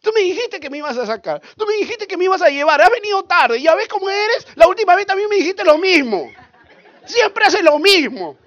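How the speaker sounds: high, loud scolding tirade; nagging wife. Acted. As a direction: berate a husband at high pitch. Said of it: "están haciendo así a su esposo".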